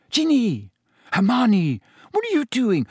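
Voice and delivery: high, startled exclamations; girl startled by a sudden collision; surprised cry. Acted.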